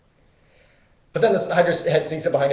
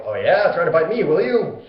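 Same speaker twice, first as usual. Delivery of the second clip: loud, higher, cocky jeer; mock taunt; 3 semitones higher, 6 dB louder than usual. Hercules taunts the Hydra after it fails, cocky. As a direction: sneer at the monster with swagger.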